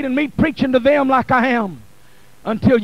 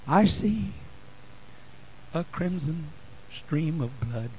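dropped right down, softer, almost intoned; hushed sermon; 7 semitones lower, 5 dB quieter than usual. Acted. Preacher to congregation, hushed and reverent intoning. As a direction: pull the church in close and hush it.

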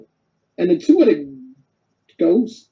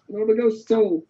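ordinary delivery

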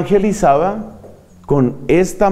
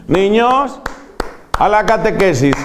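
imitating the nun; 4 semitones higher than usual. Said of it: "evangelizaba aplaudiendo".

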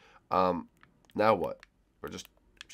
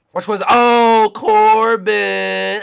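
excited; 11 semitones higher, 14 dB louder, much slower than usual. Real, not acted. Excited discovery of the seeker.

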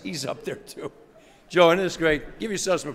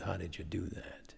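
whisper; 8 semitones lower, 12 dB quieter than usual. Retold or imitated. Asking Joe secret.

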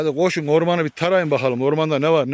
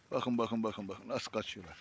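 near whisper; 3 semitones lower, 13 dB quieter than usual. Real, not acted. Watching the dogs run away.

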